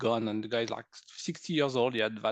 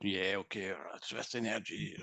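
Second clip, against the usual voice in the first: dismissive; 6 semitones lower, 8 dB quieter than usual. Retold or imitated. Mocks his earlier casual attitude.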